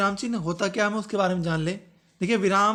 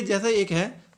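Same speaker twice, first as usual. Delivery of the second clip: normal talking voice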